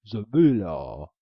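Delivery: the ogre shouts for the runaway maiden; calling out. A narrator's reading. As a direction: shout for her across the castle. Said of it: "he rose and called".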